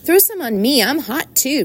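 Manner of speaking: put-on high, eager boyish plea; crying out. A narrator's reading. Boy begs for cold water too, crying out. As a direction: pipe up in a high voice. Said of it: "cried Avery".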